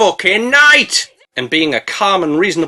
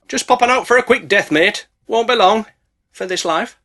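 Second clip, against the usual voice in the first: higher, breezy character voice; mock cheery bloke; 5 semitones higher than usual. Narrator voicing a doomed mate, breezy.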